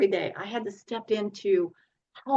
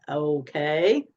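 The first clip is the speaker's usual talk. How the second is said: a drawn-out, unsure okay, lower and rising; hesitant okay; 5 semitones lower than usual. Retold or imitated. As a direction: imitate the husband's wary okay, a touch lower. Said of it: "You know how men are".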